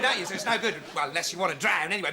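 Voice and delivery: ordinary delivery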